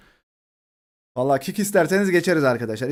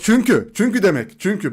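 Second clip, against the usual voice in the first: higher, louder call of çünkü; excited translation; 7 semitones higher, 5 dB louder than usual. Shown as a demonstration. Explaining to chat that because means çünkü, loud and high. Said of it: "Bak, because çünkü".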